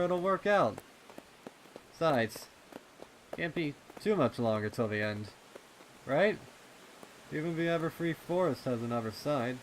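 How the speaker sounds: put-on young pony reassuring his worried best friend; Snails, encouraging. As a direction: buck him up, hopeful and a bit quick.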